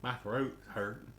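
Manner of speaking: slow mocking Southern drawl. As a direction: stretch it out like a teasing hillbilly echo. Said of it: "You're already dropping the southern".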